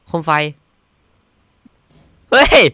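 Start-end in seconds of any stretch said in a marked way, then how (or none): 2.3-2.6 loud high shriek of surprise; startled yell. Real, not acted. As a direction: yelp at a jump scare. Jump scare in game.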